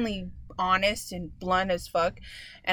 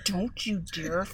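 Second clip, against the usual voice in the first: harsh hushed threat through the teeth; whisper yelling; 3 semitones lower, 6 dB quieter than usual. Retold or imitated. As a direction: threaten her in a fierce low hiss. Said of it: "but whisper screaming".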